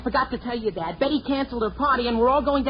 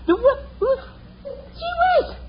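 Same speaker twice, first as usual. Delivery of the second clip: voice cracking; 13 semitones higher, slower than usual. Acted. Voice cracking back from laryngitis.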